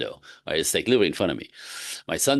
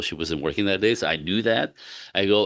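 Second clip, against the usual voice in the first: ordinary talk, nothing marked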